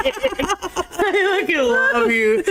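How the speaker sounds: high, slow words through laughter; helplessly amused affection; laughing hard. Real, not acted. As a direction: barely get it out for laughing. Laughing over the coworker story.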